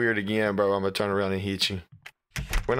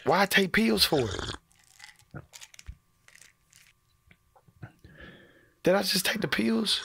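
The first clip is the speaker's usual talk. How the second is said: alarmed; 10 semitones higher, slower than usual. Real, not acted. Alarmed reaction to the pills.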